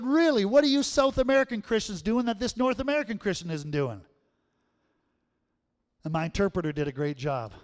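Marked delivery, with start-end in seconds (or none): 0.0-4.0 high, louder, astonished asking; amazed question. Retold or imitated. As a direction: beg them to tell you the secret. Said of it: "I said".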